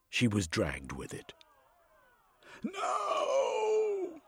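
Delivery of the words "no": very high anguished cry of no; desperate shout. A narrator's reading. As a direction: scream it as she is dragged away. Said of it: "Cherami shouted".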